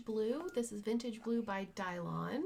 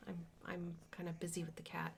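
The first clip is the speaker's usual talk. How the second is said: whispering; 3 semitones lower, 9 dB quieter, faster than usual. Real, not acted. Aside while handling the cat.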